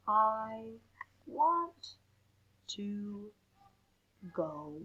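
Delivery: each word set apart in a slow, measured read; slow storybook poem voice. Acted.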